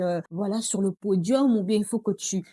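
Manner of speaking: normal talking voice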